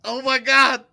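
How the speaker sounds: loud, high, startled call to halt; urgent interruption. Acted. Host halts co-hosts over breaking news, urgent and loud. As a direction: break in as if huge news just hit.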